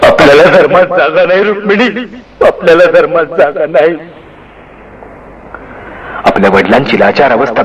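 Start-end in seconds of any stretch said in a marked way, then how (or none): 0.1-2.1 choked words. A narrator's reading. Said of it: "घुसमटलेले शब्द". 2.5-4.0 choked words. A narrator's reading. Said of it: "घुसमटलेले शब्द".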